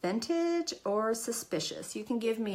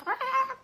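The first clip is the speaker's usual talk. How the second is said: squeaky jittery cry; high nervous squeal. Pretend. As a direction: let out a high little shriek. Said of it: "it's like".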